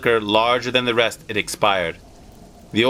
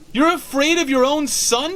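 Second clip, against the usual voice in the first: much higher, scornful frantic challenge; accusing cry. Acted. Wife scorning her husband's fear, frantic. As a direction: fling it at him in disbelief. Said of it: "she cried".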